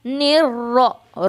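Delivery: निर-रो- with a full, rounded, heavy रो; निर-रो- read slowly, rounded and heavy. Shown as a demonstration. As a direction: round the lips for a thick रो. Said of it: "रो, ठीक है".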